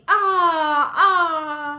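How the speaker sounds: loud, high echoing cry. A narrator's reading. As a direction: call it out like an echo off the hills. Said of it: "चारों तरफ से आवाज गूंजने लगी".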